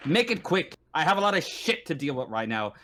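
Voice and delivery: brusque marshal, stressed and impatient; stern voice that means business; stern, businesslike. Acted. Marshal to visitors at his desk, stern. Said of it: "with a stern look. He means business".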